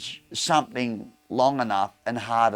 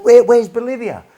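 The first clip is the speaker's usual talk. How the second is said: surprised; 10 semitones higher, 10 dB louder than usual. Retold or imitated. Dad baffled by destination.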